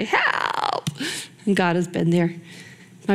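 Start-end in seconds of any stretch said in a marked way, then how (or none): 0.0-0.9 mock cry for help. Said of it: "'cause then I can say".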